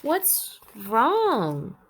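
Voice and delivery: lively read-aloud for the class; story narration. A narrator's reading. Teacher reading a picture book to her students, animated storytelling.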